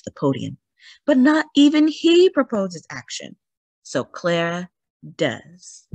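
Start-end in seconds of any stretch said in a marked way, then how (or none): none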